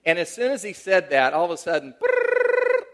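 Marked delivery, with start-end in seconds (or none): 2.0-2.8 very high loud trilled brrrr; shrill buzzing bell noise; imitated school bell. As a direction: ring out like a class bell. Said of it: "the bell rings".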